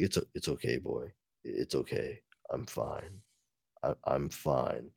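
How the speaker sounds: low, soft, hushed reassurance to the dog; quietly calm the dog while hurt; low murmured hero voice; wounded Batman soothing. Acted.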